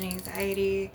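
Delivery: ordinary talk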